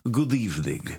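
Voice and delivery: put-on vampire greeting in a Romanian accent; a light Romanian count's accent. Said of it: "Romanian, so".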